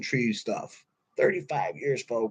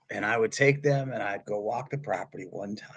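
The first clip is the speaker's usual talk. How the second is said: ordinary talk, nothing marked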